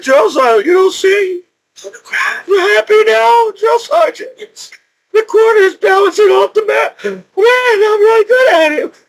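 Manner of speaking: high, teary, over-the-moon yelps of joy; overjoyed near tears. Retold or imitated. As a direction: squeal it out, almost crying with pride. Retells joy at balancing quarter.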